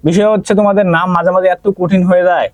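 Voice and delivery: ordinary talk, nothing marked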